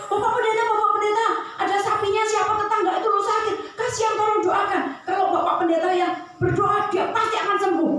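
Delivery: put-on high, loud begging child's voice; a little kid tugging at the pastor, urgent; pleading child.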